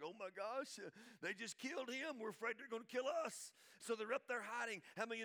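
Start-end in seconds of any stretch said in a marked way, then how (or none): 0.0-3.5 mock fear. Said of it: "They're like".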